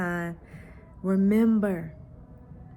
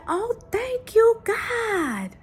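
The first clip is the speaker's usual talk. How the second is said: put-on much higher, slow, delighted child's thanks; thrilled little girl; 14 semitones higher, much slower than usual. Daughter thanking God when the power returns, high delighted child voice. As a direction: squeal out the girl's joyful thanks.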